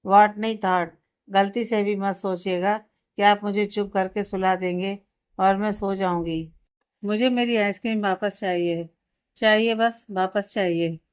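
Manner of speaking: plain reading voice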